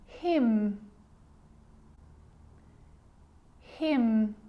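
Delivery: "Hymn" said like him, with a held M; silent N. Shown as a demonstration. Teacher giving silent N examples, lingering final M. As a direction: model "Hymn" slowly, humming out the final M. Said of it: "it actually is silent when it's next to".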